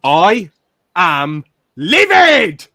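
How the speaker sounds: hammed-up fury, loud and slow; theatrical podcast rage; mock livid outburst. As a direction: play the outraged fan over the top. Mock outrage at co-host.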